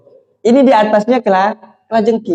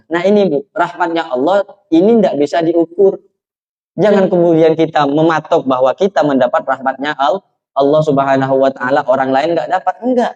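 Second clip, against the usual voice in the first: ordinary talk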